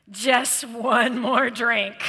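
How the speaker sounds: slow, coaxing voice of temptation; slow tempting accuser. Retold or imitated. The accuser luring an addict, slow and coaxing. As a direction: draw it out, luring them in. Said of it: "the accuser".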